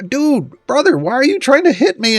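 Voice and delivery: high, put-upon sword voice; Sortle pleading. Retold or imitated. Sword asking an attacker why he swings, high and aggrieved. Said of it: "and I'd be like".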